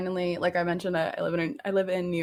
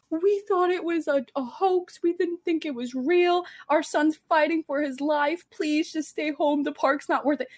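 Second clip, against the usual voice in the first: higher, imitating tearful parents on TV; mock plea from a hospital bedside; pleading news parents; 8 semitones higher than usual. Parents on the news begging viewers, imitated in a higher pleading voice.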